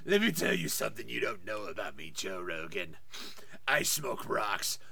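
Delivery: a low, husky celebrity confession; Harvey Fierstein impression.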